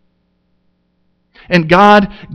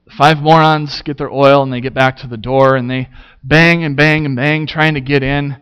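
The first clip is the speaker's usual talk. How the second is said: usual speaking voice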